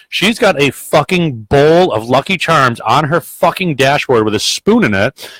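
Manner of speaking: ordinary delivery, nothing marked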